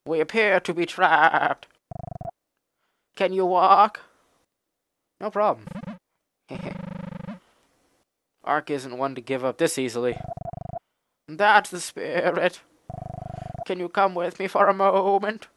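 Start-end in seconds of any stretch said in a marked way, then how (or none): 0.0-5.7 a higher, slower animal voice; lightly voiced goat. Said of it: "give him a really animalist voice". 11.3-15.5 a higher, slower animal voice; lightly voiced goat. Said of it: "give him a really animalist voice".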